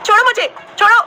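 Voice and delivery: very high, loud panicked shriek; screaming. A narrator's reading. Sneha screaming as the monkey pulls her hair. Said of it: "चिल्लाते हुए".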